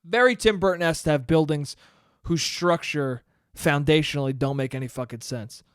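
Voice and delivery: ordinary delivery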